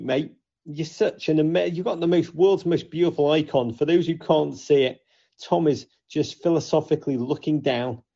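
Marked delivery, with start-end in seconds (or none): none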